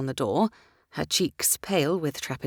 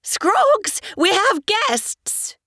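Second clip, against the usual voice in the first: high, loud, drawn-out call toward the kitchen; calling out to the maid; 7 dB louder, much slower than usual. A narrator's reading. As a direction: call the maid from another room. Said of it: "turned her head toward the kitchen".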